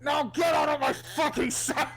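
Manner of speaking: high, loud hammed-up yell; mock furious shout. Quoting the rant clip, a hammed-up furious shout. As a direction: bellow it out like a cartoon villain.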